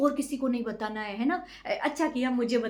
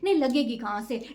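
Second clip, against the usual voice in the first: usual speaking voice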